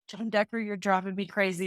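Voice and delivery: ordinary talk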